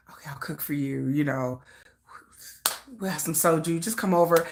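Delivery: a deep, murmured sweet-talk; low soft coaxing. Retold or imitated. Voicing [name] sweet-talking her over, low and soft.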